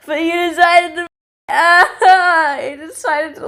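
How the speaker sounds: high-pitched, hammed-up wail of protest; high, melodramatic indignation; exasperated outburst. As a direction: burst out as if near mock tears. Mock outrage at the writers.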